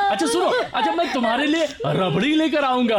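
put-on husband coaxing the sobbing maid in a high baby voice; cooing baby talk. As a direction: soothe her like a crying toddler.

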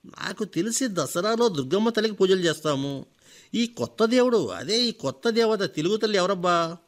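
sharp, scornful challenge; harsh question. Acted. Old teacher scoffs at the visitor, harsh and scornful. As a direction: bite into it harshly. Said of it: "కరుగ్గా అడిగాడు".

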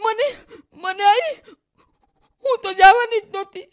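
very high, loud wail, stammering through tears; hiccuping sobs. A narrator's reading. Girl confessing to mother she was beaten, sobbing. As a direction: cry out each phrase, breaking off mid-sentence. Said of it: "હિપકા ભરતી હતી ને બોલતી હતી".

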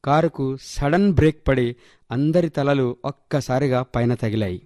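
plain reading voice

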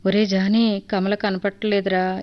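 ordinary delivery, nothing marked

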